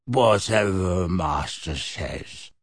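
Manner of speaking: slow, low croaking elf voice; creaky house-elf. Acted. House-elf croaking to Sirius, slow and low. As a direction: bent old servant elf, croaking slowly.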